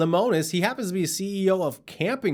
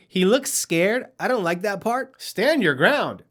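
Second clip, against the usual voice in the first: ordinary delivery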